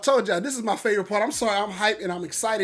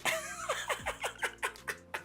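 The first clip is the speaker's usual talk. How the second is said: an unvoiced, high breathy giggle; breathy high laugh; 12 dB quieter than usual. Real, not acted. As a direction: let a silent wheezing laugh out. Bursts out laughing.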